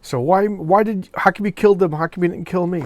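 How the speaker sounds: distressed asking. Retold or imitated. Voicing Brandy questioning Rom.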